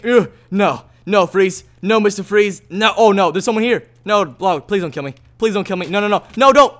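loud, rising panicked begging; panicked pleading. Real, not acted. Gamer begging the killer Log not to kill him, in loud panic. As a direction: beg for your life as the attacker closes in. Being attacked in game.

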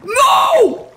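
high repeated anguished shouts; desperate screaming. Real, not acted. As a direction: scream no over and over. Desperate cries over game disaster. (gritos desesperados)

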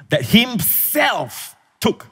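slow, lifted "himself"; stress on himself. Shown as a demonstration. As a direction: stretch "himself" high and slow. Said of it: "the emphasis is himself".

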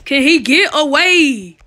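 dramatic voice. Pretend. Dramatic game commentary.

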